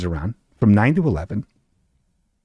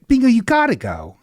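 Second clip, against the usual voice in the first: mock urging friend; 11 semitones higher than usual. Quoting friend Desiree's push.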